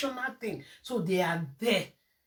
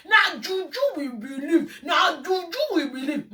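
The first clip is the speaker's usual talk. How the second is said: mock imitation; 6 semitones higher than usual. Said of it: "and say".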